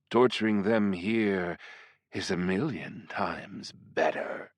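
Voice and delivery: slow, teasing demon seductress; flirtatious demoness. Acted. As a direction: purr each threat slowly and playfully. Voices the succubus.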